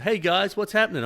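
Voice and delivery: high, breezy "hey guys" hello; cheery greeting. Retold or imitated. Man walking up to pickleball friends, cheery greeting.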